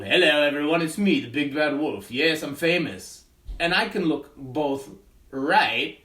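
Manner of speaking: big bad wolf, loud and proud; wolf puppet voice. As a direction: boast like a showy puppet wolf. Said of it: "I'll show you with the wolf".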